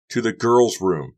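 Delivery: loud, slow shout back. Acted. Becca shouts back to Carrie, loud and slow. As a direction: shout it over your shoulder. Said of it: "Becca shouted".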